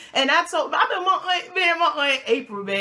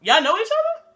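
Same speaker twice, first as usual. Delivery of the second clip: high, loud surprised question; mock surprise; 10 semitones higher, 6 dB louder than usual. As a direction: imitate someone suddenly caught off guard. Acts Fitz finding out.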